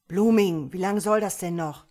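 laut und ungeduldig gerufen; ungeduldiger Ruf. A narrator's reading. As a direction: genervt ins Funkgerät rufen. Ungeduldiger Ruf ins Funkgerät. (lautes, ungeduldiges Nachhaken)

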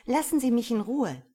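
loud, high rebuff; indignant demand. A narrator's reading. Woman to the man blocking her, indignant. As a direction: raise pitch and volume to fend him off. She tries pushing past him.

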